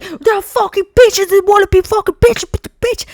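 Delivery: high, loud cursing rant; mock rant. Ranting about the dealers, high and angry. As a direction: spit it out furious and laughing. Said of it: "And I'm like".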